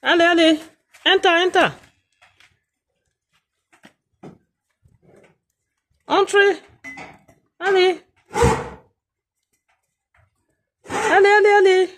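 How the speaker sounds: high sing-song call across the yard. Real, not acted. Calling the animal by name.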